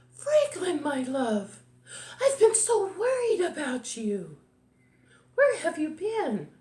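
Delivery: high, fretting mother voice; worried mother. A narrator's reading. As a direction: fuss anxiously over a lost child. Worried mother greets lost son.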